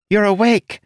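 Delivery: high nasal creature voice; squeaky nasal little creature; nasally voice. A narrator's reading. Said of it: "in his nasally voice".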